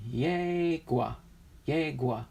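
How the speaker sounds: "Yegua" with a stretched first syllable, then shorter; tentative, drawn-out "Yegua"; trying a pronunciation. Shown as a demonstration. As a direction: sound out "Yegua" as YAY-gwah. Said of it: "Is that what it is?".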